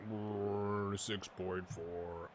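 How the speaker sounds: low, soft, slow grumble; voicing a weary player. Gamer voices tired De Silva's poor rating, low slow groan. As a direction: drag it out like a drained player. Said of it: "He's like".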